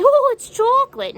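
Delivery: high, loud, sing-song fake innocence; mock innocent excuse. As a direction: squeal it like a cheery villain. Mocking killers' excuse.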